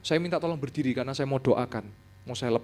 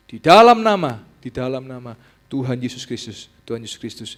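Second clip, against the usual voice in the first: call and echo prayer; 3 semitones higher, 12 dB louder than usual. Retold or imitated. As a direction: lead the prayer line by line. Repeating prayer after pastor. (doa diulang keras lalu pelan)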